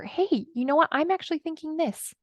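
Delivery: lightly voiced high, small voice; little inner voice. Inner voice admitting what it thinks, little voice. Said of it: "that little voice comes up".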